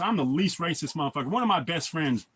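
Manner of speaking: ordinary delivery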